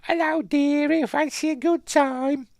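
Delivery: very high, loud falsetto come-on; hammed-up falsetto greeting from a tavern woman; flirty woman impression. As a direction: put on a squealing mock-female voice. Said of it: "I bet she's saying that".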